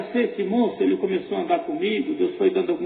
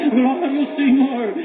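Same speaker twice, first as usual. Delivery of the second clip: drawn-out praise exclamation; exultant praise; 6 semitones higher, 5 dB louder, slower than usual. Real, not acted. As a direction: cry it out in worship, stretching the words. Preacher exclaims praise mid-testimony.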